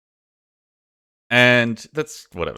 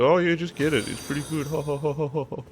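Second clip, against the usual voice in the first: imitating mate; 7 semitones higher, 4 dB quieter, faster than usual. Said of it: "He's like".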